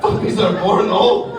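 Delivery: baffled comic echo; incredulous repeat. Acted. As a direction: echo it back in utter disbelief, rising at the end.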